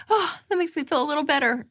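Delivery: high sigh of mock relief; acted relief after sadness; sad self-soothing. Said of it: "you cope your sadness with spending".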